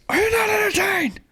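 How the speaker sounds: mock shout. Fan imitating how the hero actually said it, shouted. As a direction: shout it high without drawing it out. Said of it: "He's just like".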